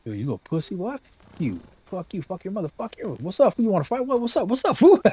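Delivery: hammed-up schoolyard punk picking a fight; mock tough kid taunt. Kid taunting the starer to fight, mock tough voice. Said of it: "I just like".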